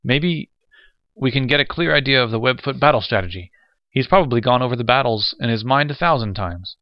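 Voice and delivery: high, loud eager voice. A narrator's reading. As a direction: rush on eagerly. West keeps eager pitch.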